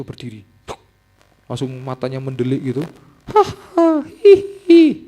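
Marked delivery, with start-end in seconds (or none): 3.3-5.1 high, loud eerie cackling laugh; possessed cackle. Retold or imitated. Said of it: "kayak gitu". (tawa melengking kerasukan)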